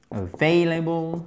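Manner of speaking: slow "Available", drawing out the "vai" glide; stressed diphthong. Shown as a demonstration. Teacher repeats "Available" for learners, slow stressed diphthong. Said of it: "Accent tonique ici. C'est une diphtongue".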